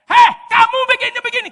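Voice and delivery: high, loud and fast scolding shout; mock yell. Preacher acting a newlywed yelling at a partner, mock shout. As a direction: snap at the new partner. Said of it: "teriak-teriak".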